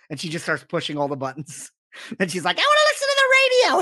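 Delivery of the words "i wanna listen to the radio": much higher, fast squeaky Harley; Harley Quinn voice. Retold or imitated. Retelling Harley pushing buttons, squeaky fast Harley voice. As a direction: play the giddy Harley in the Batmobile.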